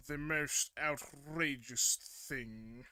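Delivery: loud, slow heroic announcement. Acted. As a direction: boom it out like a hero. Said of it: "Oh, the editor hero voice".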